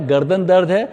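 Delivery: ordinary delivery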